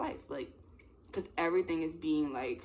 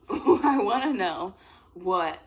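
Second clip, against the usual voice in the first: loud, slow, through a laugh; amused and drawn out; 3 semitones higher, 9 dB louder, much slower than usual. Real, not acted. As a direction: laugh your way back into it. Laughs after slip on cursing.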